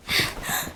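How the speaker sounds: shocked gasp. Acted. Shocked at the dark spirit.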